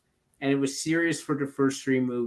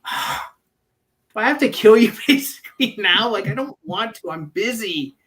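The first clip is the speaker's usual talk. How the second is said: imitating exasperation, pitched up; put-upon killer's mock complaint, higher voice; annoyed reluctant killer; 7 semitones higher, 4 dB louder than usual. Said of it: "she's annoying him".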